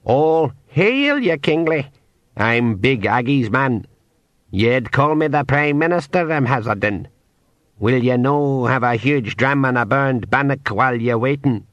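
a courtly pixie greeting his king. Acted. Pixie prime minister speech.

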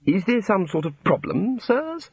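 lightly voiced prim housekeeper, rising on the question; a hint of polite suspicion in a primly voiced figure. A narrator's reading. Housekeeper questioning the wizards, lightly prim.

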